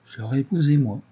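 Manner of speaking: usual speaking voice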